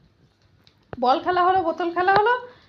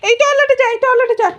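high, loud singsong coaxing the dog; pet baby talk; 12 semitones higher, 11 dB louder than usual. Acted. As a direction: talk to him like a toddler, high and bright. (উঁচু গলায় আদুরে সুরে কুকুরকে ডাকা)

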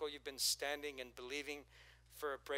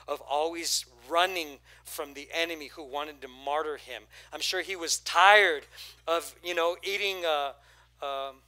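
preaching at full force; 9 dB louder than usual. Acted. Pastor to congregation, shouting about tiredness. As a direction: hammer each question home.

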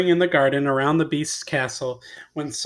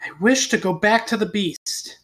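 a firm, higher, resolved wish; said firmly; 5 semitones higher than usual. Acted. Beauty makes her wish on the locket, firmly. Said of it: "said firmly".

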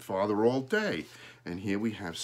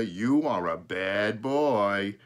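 slow, louder drawn-out reproach; slow, emphatic teasing telling-off; mock scolding; 2 semitones lower, 5 dB louder, much slower than usual. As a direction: stretch it out in playful agreement. Father fondly mock-scolds the crow.

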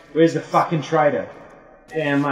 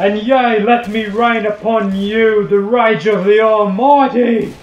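slow, loud booming preacher; 6 semitones higher, 5 dB louder, slower than usual. Acted. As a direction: thunder it like a fire-and-brimstone priest. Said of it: "we're actually doing accents".

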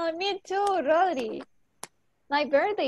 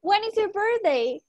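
higher and louder, a bright question for the class; teacher asking loudly; 7 semitones higher, 5 dB louder than usual. Shown as a demonstration. Teacher calls the birthday question loudly to her students. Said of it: "¿Cuándo es tu cumpleaños?".

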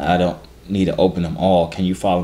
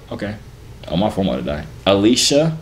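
ordinary delivery

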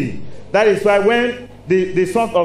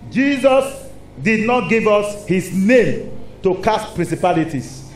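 ordinary delivery

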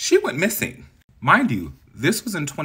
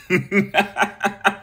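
short real laugh at her own joke; a quick giggle after the punchline; amused laugh; 9 semitones higher, 4 dB louder than usual. Real, not acted.

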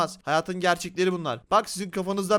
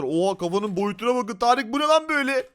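ordinary talk

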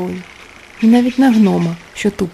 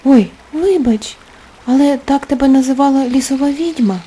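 justifying herself; 5 semitones higher, 4 dB louder than usual. Acted. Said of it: "стала виправдовуватись принцеса".

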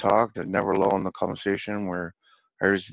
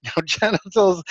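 high-pitched mock-dramatic exclamation; parody of himself; 9 semitones higher, 3 dB louder, slower than usual. Friend playing him in a parody, hammed-up. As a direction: send yourself up, high and overdramatic. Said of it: "he's playing me going".

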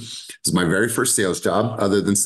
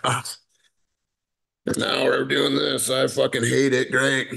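reluctant sulky caller. Retold or imitated. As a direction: drag through the words like you dread the call. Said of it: "You should never go into a call situation be like".

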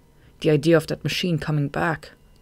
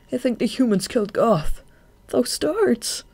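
high-pitched, agitated report; distressed; 8 semitones higher than usual. A narrator's reading. Shade reports the humans' attack to Marina, distressed. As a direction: pitch it up, rattled by the memory.

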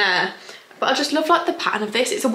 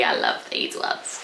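slow, hushed whisper; adoring whisper; 6 dB quieter, slower than usual. Real, not acted. Vlogger picking her favourite cargos, adoring whisper. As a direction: whisper it slowly, smitten.

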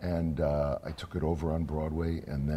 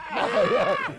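excited cheer; 13 semitones higher, 8 dB louder than usual. Retold or imitated. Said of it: "they're all like".

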